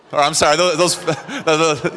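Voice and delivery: stumbling over words, laughing; breaking into laughter. Real, not acted. Cracks up while telling his joke.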